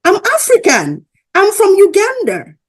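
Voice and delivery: high, loud, emphatic retold answer; imitating him declaring it loudly; imitated insistent man. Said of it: "He said".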